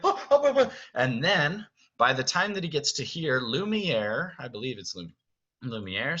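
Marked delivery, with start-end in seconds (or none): 0.0-0.7 high, loud startled ohs; scattering in alarm; alarmed crowd. Acted. Staff watching Beast storm through, alarmed. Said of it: "everybody's just like".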